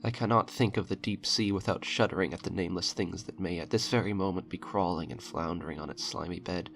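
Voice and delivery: usual speaking voice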